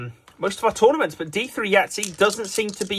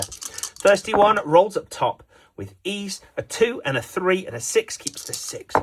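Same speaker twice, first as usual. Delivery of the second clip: ordinary talk, nothing marked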